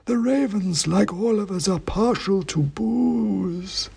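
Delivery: high, slow, dusty oracle voice; dusty faint ancient head. A narrator's reading. Ancient king's head answering the wizard, slow high dusty chant. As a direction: intone it like a faint, dusty myth.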